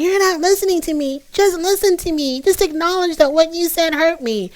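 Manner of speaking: put-on high-pitched whiny toddler-style protest; hammed-up temper tantrum in a high voice; mock tantrum. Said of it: "throwing a temper tantrum".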